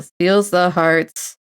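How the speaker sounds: very slow drawn-out words; slow syllable count. Shown as a demonstration. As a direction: tap out each syllable. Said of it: "that's one syllable".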